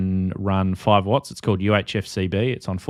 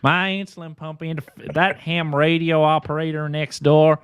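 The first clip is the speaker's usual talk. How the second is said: put-on Southern accent; 8 semitones higher than usual. Blaming the ham operator next door, mock Southern lament. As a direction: build to a hammed-up Southern wail. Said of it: "default to a Southern accent".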